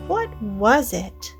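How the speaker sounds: ordinary delivery, nothing marked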